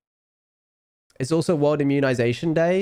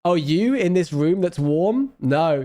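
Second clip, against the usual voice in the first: ordinary delivery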